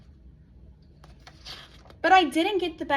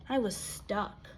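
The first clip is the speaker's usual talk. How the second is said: defeated; 3 semitones lower, 7 dB quieter, slower than usual. Acted. Defeated after failures.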